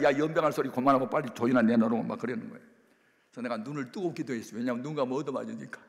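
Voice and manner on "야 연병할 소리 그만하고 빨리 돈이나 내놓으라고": fast and louder, cutting him off; angry retort. Retold or imitated. Mother cuts off the pastor's prayer, fast loud retort. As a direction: brush the prayer aside impatiently.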